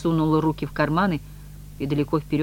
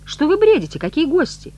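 much higher, louder incredulous questions; indignant disbelief; 13 semitones higher, 8 dB louder than usual. A narrator's reading. Woman rebuffing a stranger's invitation, indignantly incredulous. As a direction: cry out in sharp bewilderment.

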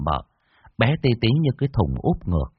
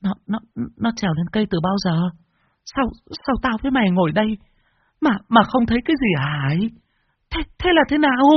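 high-pitched, shocked stutter; stammering; 12 semitones higher than usual. A narrator's reading. Stunned old woman asks her daughter-in-law how it happened, stammering. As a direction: trip over repeated first words in disbelief. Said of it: "Bà lắp bắp".

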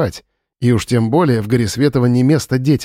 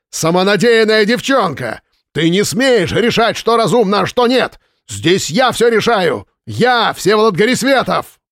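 high-pitched furious yelling at an employee; angry shouting; 8 semitones higher than usual. A narrator's reading. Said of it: "его голос сорвался на крик".

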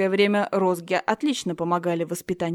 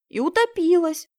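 high eager chime-in; chiming girl; 9 semitones higher, 5 dB louder than usual. Acted. Friend chiming in to the teacher, high and eager. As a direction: add it gleefully. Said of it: "поддакнула подружка".

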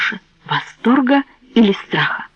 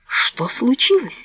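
frightened; 5 semitones higher, 4 dB quieter, slower than usual. Acted. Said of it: "испугался Анджело".

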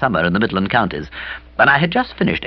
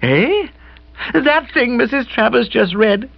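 put-on higher young woman, sheepish and rushed; contrite Bobby; 10 semitones higher than usual. A narrator's reading. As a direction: own up to him sheepishly.

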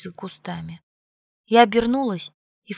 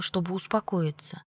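ordinary delivery, nothing marked

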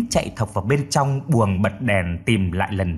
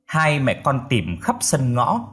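plain reading voice

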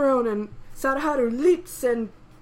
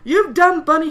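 loud, higher acted outburst; angry shout; 4 semitones higher, 11 dB louder than usual. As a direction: yell at the animal biting you. Acted yelp while being bitten.

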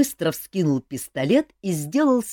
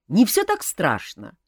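ordinary delivery, nothing marked

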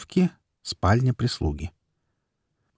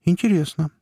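high intrigued remark; 9 semitones higher than usual. A narrator's reading. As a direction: sound genuinely surprised. Surprised reaction to news.

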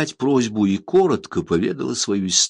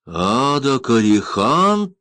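slow, high, loud cry of surprise; startled exclamation; 6 semitones higher, 5 dB louder, much slower than usual. A narrator's reading. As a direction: blurt the name in shock. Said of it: "воскликнул лорд Гвилок".

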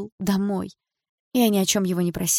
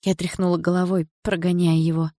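plain reading voice

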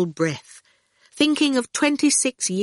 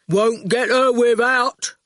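slow, higher, heavy delivery; blunt digger driver; 5 semitones higher, 4 dB louder, slower than usual. A narrator's reading. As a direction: insist in drawn-out words. Erskine's character voice.

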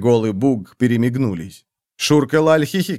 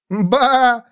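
cackled; 9 semitones higher than usual. A narrator's reading. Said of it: "загоготала Шурка Лаль".

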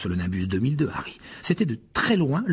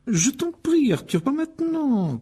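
much higher, slow exasperated mother; cutting in; slower than usual. Acted. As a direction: stop him mid-question. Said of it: "coupa madame Wesley". (Molly l'interrompt, exaspérée)

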